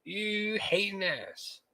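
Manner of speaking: playful high-pitched jab at the friend; mock outrage. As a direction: squeal the insult, then stretch it out teasingly. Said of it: "I was like".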